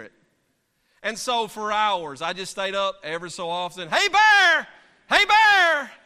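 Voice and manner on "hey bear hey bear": repeated yelling. Retold or imitated. Yelling at intervals all night.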